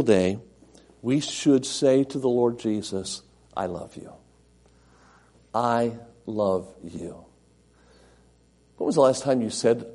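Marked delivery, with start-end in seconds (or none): 3.6-7.2 softer, hushed, loving declaration; tender devotion. Pretend. Preacher modelling his love for Jesus, hushed and tender. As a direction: breathe it out as a quiet love vow.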